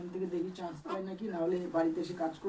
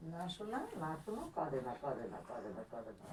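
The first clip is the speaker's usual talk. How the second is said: soft, low repeated hushing; 5 semitones lower, 5 dB quieter than usual. Real, not acted. Parent telling baby not to cry, soothing softly.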